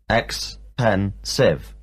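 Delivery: ex-pen-sive said slowly in three beats; teacher tapping out ex-pen-sive syllable by syllable. Shown as a demonstration. Teacher explaining adjectives, slowly splitting ex-pen-sive into syllables. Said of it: "expensive has three syllables".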